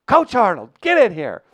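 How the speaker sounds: high, loud imitated yell; screaming teacher. Teacher calling the coach into the room, screaming. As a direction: holler it out as an angry teacher. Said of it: "famous for screaming at people".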